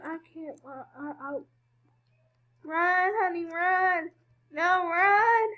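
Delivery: usual speaking voice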